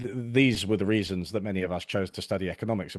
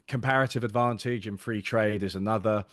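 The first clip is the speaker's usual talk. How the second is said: ordinary talk, nothing marked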